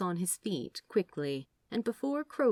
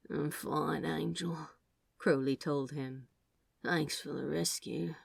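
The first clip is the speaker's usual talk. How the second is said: barely any strength left; drained serpent thanking the angel softly; 5 dB quieter than usual. Acted. Exhausted dragon thanks angel.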